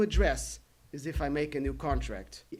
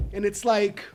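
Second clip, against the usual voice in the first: ordinary talk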